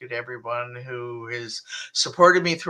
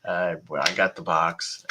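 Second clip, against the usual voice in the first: ordinary delivery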